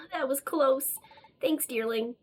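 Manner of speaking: normal talking voice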